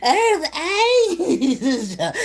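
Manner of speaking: mock gushing high Earth Angel; mocking praise. Mocking the woman praising the girl, high gushing voice. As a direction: gush Earth Angel, laughing at it. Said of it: "she's sitting over there calling her".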